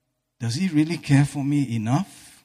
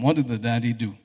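ordinary talk

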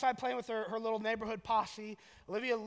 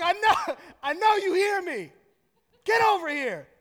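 put-on exasperated high shout at a kid who ignores you; loud, over-the-top parental yelling; screaming dad; 8 semitones higher, 10 dB louder than usual. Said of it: "screaming dad voice".

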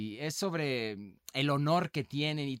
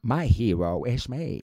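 low, slow Texan drawl, a bit louder; Texan accent impression; 4 semitones lower, 5 dB louder, slower than usual. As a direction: channel McConaughey's Texas drawl. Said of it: "acento tejano". (acento tejano de McConaughey, lento y grave)

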